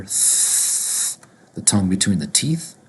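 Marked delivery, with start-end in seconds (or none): 0.0-1.2 long voiceless "th-" hiss; TH sound alone. Shown as a demonstration. Teacher isolating the TH for learners, drawn-out hiss. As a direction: hold "th-" with tongue between the teeth. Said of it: "T H together".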